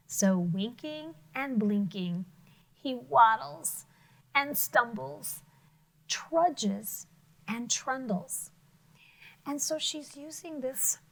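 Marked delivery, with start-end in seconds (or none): 0.1-8.5 slow, drawn-out storybook reading; slow descriptive reading. Shown as a demonstration. Teacher reading the toad's clumsy moves slowly to children. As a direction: stretch each action word out slowly. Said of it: "Let's read those again".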